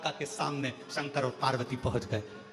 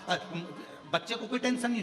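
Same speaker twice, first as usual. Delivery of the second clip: ordinary talk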